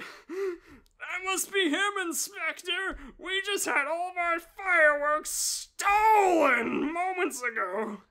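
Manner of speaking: high, loud urgent cry; urgent high alarm. Acted. Dan urging the inspector on, high and loud.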